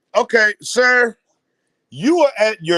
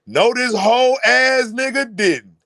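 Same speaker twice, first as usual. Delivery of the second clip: higher, slow, drawn-out mock outrage; mock outraged disbelief; 4 semitones higher than usual. Imitating a father told to shut up, drawn-out outrage. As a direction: stretch out the insulted father's disbelief. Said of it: "He looking like".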